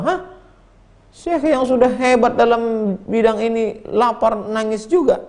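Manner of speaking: high, incredulous disciple; acted disbelief. Disciple challenges a great sheikh crying over hunger, acted disbelief. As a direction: question it in high-pitched mock amazement.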